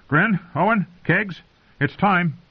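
high-pitched roll call of names; summoning his men. A narrator's reading.

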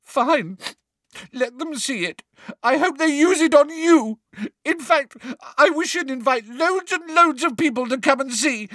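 very high, loud tearful tirade; girl fake-sobbing threats at a teacher; sobbing outburst. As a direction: sob out a spiteful wish. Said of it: "now sobbing into her hands again".

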